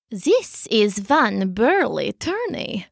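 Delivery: low, slow cat voice. A narrator's reading. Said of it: "said Luda".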